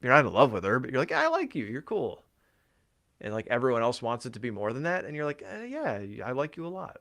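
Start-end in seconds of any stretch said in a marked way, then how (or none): 1.1-2.2 mock-friendly, pitched way up on "I like you"; high, casual let-down to a friend; friendly brush-off. Telling a girl you're not in love with, friendly brush-off. 5.4-7.0 hesitant, high-pitched hedging; gently noncommittal, voice lifted and a bit softer; reluctant affection. Acted. Answering when everyone wants it to be more, reluctant affection.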